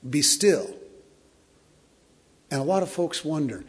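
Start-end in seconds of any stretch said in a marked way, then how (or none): 0.1-0.7 Jesus commanding calm. Retold or imitated. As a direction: command the storm to be still. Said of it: "and he says".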